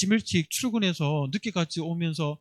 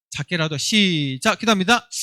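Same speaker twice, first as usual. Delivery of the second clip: usual speaking voice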